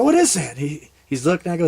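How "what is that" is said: high, loud mock-curious question; feigned puzzlement. Hunter showing buddy something on ground, feigned puzzlement. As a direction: play innocent and amazed.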